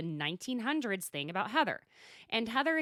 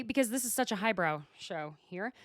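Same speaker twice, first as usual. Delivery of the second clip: usual speaking voice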